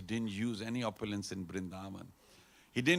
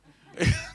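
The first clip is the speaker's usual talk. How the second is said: high laugh; 9 dB louder than usual. Acted. Laugh inside the mother scene.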